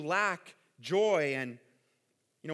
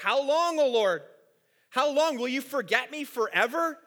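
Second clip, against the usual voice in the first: raised, higher anguished plea, louder; crying out to God; 7 semitones higher, 6 dB louder than usual. Retold or imitated. Preacher voicing David crying out to the Lord, raised anguished plea. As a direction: cry it out like a desperate psalm. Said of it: "crying out to the Lord".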